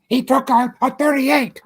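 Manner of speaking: high, loud yells of no and pow; shouted reenactment. Retold or imitated. [name] reenacting the gunshots for Jerry, shouting. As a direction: shout it like shots are firing.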